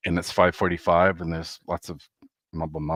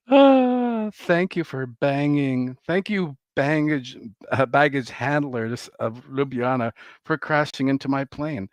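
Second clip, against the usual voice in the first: mock gratitude; 6 semitones higher, slower than usual. Real, not acted. Mock thanks to baggage handlers.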